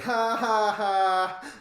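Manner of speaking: loud, high taunting ha ha ha; mocking laugh. Bananas pointing and jeering at TO, loud mocking laugh. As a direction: jeer at him with a fake laugh. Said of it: "Banana's like".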